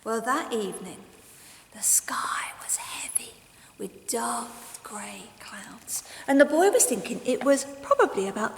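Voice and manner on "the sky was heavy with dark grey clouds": slow, soft ominous whisper; hushed. A narrator's reading. Narrator darkening the tale, slow whisper. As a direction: whisper it like a warning.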